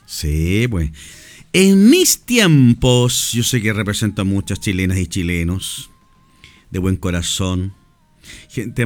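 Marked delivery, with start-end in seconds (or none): 1.5-3.3 higher and louder, stretched long; slow, raised and emphatic; louder drawn out. Shown as a demonstration. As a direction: call it out slowly and loudly.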